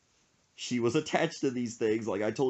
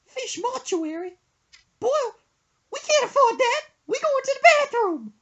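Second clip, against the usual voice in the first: high loud falsetto; mom scoffing; 9 dB louder than usual. Retold or imitated. Mom telling son they can't afford a mortuary, high loud. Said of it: "She said".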